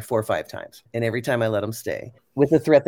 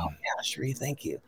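quiet, softer and lower grateful reply; soft thanks; 7 semitones lower, 9 dB quieter than usual. Retold or imitated. Young woman calmed by the memory, quietly thanking Cherie. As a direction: let it out gently, comforted.

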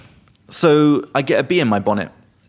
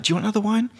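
much higher, lilting offer; mimicking her; 11 semitones higher, much faster than usual. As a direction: lift the whole line up high like her. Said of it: "She was like".

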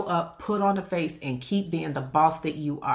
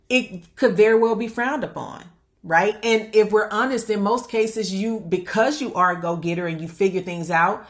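ordinary talk

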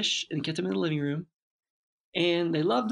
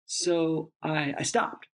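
ordinary talk, nothing marked

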